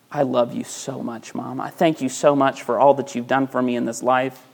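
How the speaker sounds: soft, hushed loving thanks; a soft, sweet thank-you to mom; tender to mom. Pretend. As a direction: speak softly to your mother. Child to own mother, tender.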